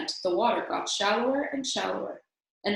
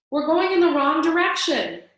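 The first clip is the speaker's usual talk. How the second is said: higher, louder call against the wind; shouting over the storm; 8 semitones higher, 7 dB louder than usual. A narrator's reading. Elmer to the dragon over the rumbling storm, shouting. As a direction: yell it so he's heard through the storm. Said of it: "cried through the tr-, rumbling storm".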